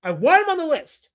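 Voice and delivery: high-pitched indignant demand; mock outrage. Streamer demands Jack put the offender on the list, mock-outraged. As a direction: insist on it, voice pitched right up.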